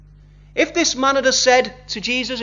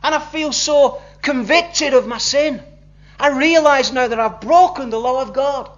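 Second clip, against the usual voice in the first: higher and louder, pleading confession; crying out; 5 semitones higher, 5 dB louder than usual. Retold or imitated. Convicted man crying out his sin to Jesus, higher, louder pleading. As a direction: call out to the Lord, earnest and pleading. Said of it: "had sort of said and cried out".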